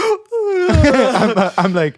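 cracking up mid-sentence; laughing. Real, not acted. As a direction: laugh through the words. Laughing while retelling.

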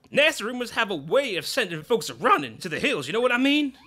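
big folksy showman boss; Grubba's voice. Acted. Boss telling manager to hush up the rumors.

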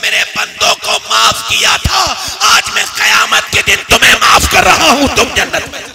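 loud, high, ringing sermon climax; impassioned preaching. Acted. Preacher to congregation, loud and soaring on forgiveness. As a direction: preach it out to the crowd at full force.

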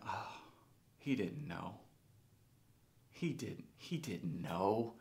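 soft, halting, dawning realization; hushed realization. Retold or imitated. Man watching his old coach at the gym, hushed realization. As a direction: let it sink in softly, with pauses. Retold moment of realization and forgiveness.

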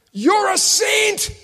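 high, slow, drawn-out cry; excited exclamation. Retold or imitated. Mother greeting the preacher, high and slow with joy. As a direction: let it ring out high and slow.

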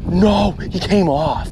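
real letdown as the fish slips free; real dismay. Real, not acted. Angler losing a fish on camera, slow dismayed cry. Fish just got off the line.

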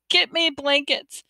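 high, slow, urgent plea; begging. A narrator's reading. Mother sending her son for blankets, begging. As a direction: beg with stretched words. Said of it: "Mom begged".

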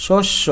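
শস্য as "shosh-sho"; final o vowel. Shown as a demonstration. Teacher showing o-kar ending, শস্য as "shosh-sho". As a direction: end শস্য on a round o. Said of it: "শটা ওকার হয়ে গেল".

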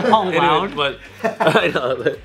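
ordinary delivery

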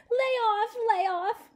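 high, pleading cry; begging. Acted. Magoon begging the tiny men to stop. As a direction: beg for mercy under the blows. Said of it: "begged Magoon".

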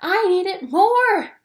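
insist, almost begging; pleading cry. A narrator's reading. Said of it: "cried Lou".

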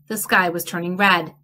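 usual speaking voice

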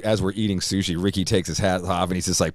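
ordinary talk, nothing marked

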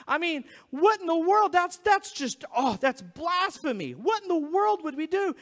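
high, loud mock outrage; scandalized. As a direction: react as if hearing blasphemy. Acting the shocked temple crowd.

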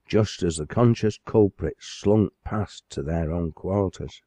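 ordinary delivery

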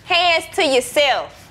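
slow, loud stern order; stern warning. Acted. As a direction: lay down the law slowly. Mom scolding the kids.

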